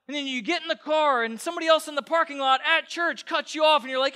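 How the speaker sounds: high, loud, fired-up preacher; full-force preaching. Real, not acted.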